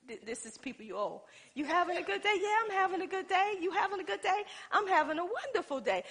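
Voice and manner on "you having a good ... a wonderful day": butter up the person she owes; sugary phone greeting. Retold or imitated. Acting sweet phone call.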